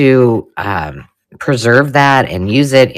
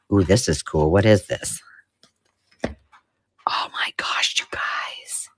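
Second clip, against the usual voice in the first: soft, slow whisper of wonder; hushed amazement; awed whisper; 4 semitones lower, 7 dB quieter, slower than usual. Real, not acted. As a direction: breathe it out as if in a museum. Hushed awe over old items.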